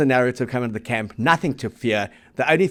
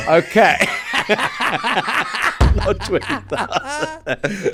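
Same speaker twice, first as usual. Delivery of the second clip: laughing it out slowly; 7 semitones higher, much slower than usual. Real, not acted. Host laughing at a listener's two-thousand-crowd message.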